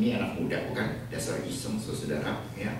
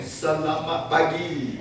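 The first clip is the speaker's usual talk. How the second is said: very slow, high, louder, with a laugh; mock careful Indonesian; 8 semitones higher, 6 dB louder, much slower than usual. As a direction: say it like a proud learner. Korean pastor speaks Indonesian.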